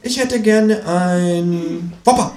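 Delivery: high, slow, stubborn repeat; kangaroo voice. Acted. Kangaroo orders the Whopper yet again, slow and high. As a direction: insist sweetly, dragging it out. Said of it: "sagt das Känguru".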